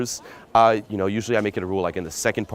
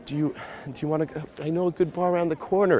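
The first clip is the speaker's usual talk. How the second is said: hesitant, higher-pitched mock timid asking; permission-seeking; 7 semitones higher than usual. Shown as a demonstration. Pickup coach imitating a man suggesting a bar, permission-seeking. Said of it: "just very permission-seeking".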